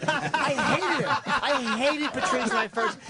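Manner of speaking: high, slow venting with laughter; comic exasperation. Real, not acted. Comic venting about first meeting.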